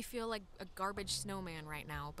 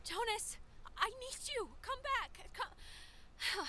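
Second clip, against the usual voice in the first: high pleading shout; desperate calling; 12 semitones higher, much slower than usual. Acted. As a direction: call him back, desperate. Friend vanished, calling after him.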